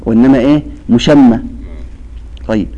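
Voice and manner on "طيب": ordinary delivery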